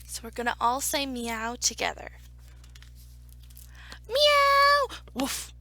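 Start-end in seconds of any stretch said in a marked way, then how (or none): 4.1-5.0 high, loud, drawn-out meow; cat's meow. Acted. Storyteller leading everybody in the story's meow, high and loud. Story chorus of animal sounds. 5.1-5.5 short, quick woof; one abrupt doggy woof; dog's woof. Acted. Dog interrupting the meowing in a picture book, short woof.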